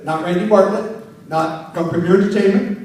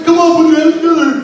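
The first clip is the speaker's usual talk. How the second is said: higher, louder "put your hands together"; mock hype call; 8 semitones higher, 6 dB louder, faster than usual. MC mocking cheesy crowd hype, loud and high. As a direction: pump up the crowd. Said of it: "I don't have to say".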